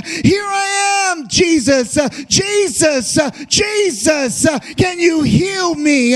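desperate shout. Acted. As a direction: call out to be heard over the crowd. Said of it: "he shouts and he says".

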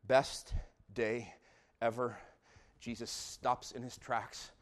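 usual speaking voice

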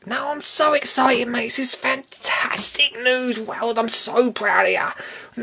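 high, drawn-out gushing praise; put-on high voice, overjoyed for a mate; slow, high, over-the-top congratulations; Biggsy impression. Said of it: "he doesn't sound like this".

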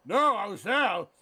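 put-on loud, high, slow drunken protest; drunk Brian Cox. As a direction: slur it out in protest. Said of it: "He's drunk again".

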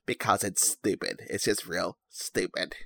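low, soft put-on voice; a lightly done daft character drawing out the ending; silly made-up voice. Host mocking his own impressions, silly made-up voice. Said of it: "I don't know what voice that is".

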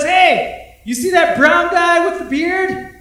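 high, loud taunting call; imitating a stand-up heckling the crowd; mock heckler shout. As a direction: shout it across the room to single him out. Heckler calls out across room.

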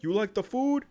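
hammed-up mafia boss voice; gangster voice. Retold gangster dinner scene.

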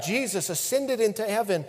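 ordinary talk, nothing marked

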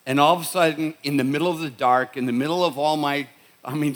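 normal talking voice

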